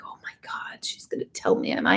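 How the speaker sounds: breathless whispered hope; hushed, eager inner voice; excited whisper. Retold or imitated. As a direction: whisper it like a secret thrill. Retelling her eager inner thought.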